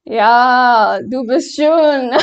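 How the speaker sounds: ordinary talk, nothing marked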